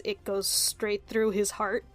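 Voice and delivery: usual speaking voice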